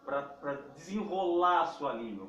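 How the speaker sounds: usual speaking voice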